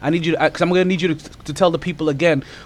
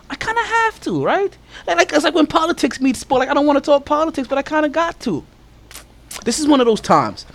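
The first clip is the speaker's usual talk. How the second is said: excited; 11 semitones higher than usual. Real, not acted. Excited, high-pitched aside.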